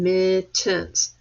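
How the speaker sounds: "Mittens" broken into mit, tens; two separate beats on "Mittens"; syllable by syllable. Shown as a demonstration. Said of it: "Did you get two syllables".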